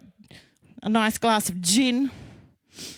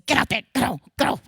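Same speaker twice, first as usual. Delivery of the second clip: urging a horse; 4 semitones higher than usual. Retold or imitated. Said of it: "like a little horsey".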